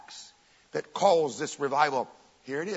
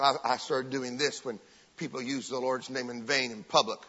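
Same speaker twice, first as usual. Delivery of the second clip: ordinary talk, nothing marked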